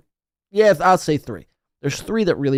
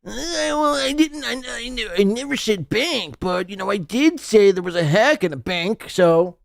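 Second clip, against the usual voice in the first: high, whiny mock stammer; mocking the creator as a shifty dodger; mocking shifty guy; 7 semitones higher than usual. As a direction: squirm like a shady liar caught out. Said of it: "look how fucking shifty and shady this guy is".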